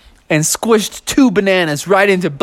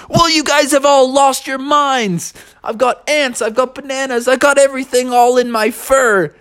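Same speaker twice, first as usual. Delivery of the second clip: high, indignant character voice; exasperated complaint; 8 semitones higher than usual. Acted. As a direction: rant at guests who've gone crazy. Bear exasperated at messy guests.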